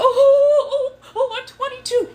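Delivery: high, whimpering laugh-cry mockery; high whiny voice, half crying and half laughing; mocking whiny crybaby. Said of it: "You're talking about".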